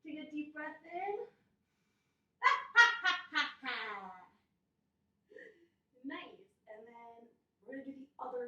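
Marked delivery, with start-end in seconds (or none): 2.4-4.3 loud cackling witch laugh; loud witch cackle. Shown as a demonstration. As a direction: cackle like an old hag. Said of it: "cackle together".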